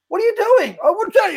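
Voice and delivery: high, yelling at the star of the show; mock yell. Actor yelling at the star on set, hammed-up. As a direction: bark it at him in mock anger. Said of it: "I yell at him".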